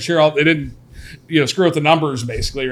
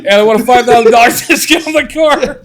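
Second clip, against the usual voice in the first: loud, high mocking customer voice; 10 semitones higher, 9 dB louder than usual. Co-host mocking a demanding customer, loud and high. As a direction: lampoon the pushy buyer.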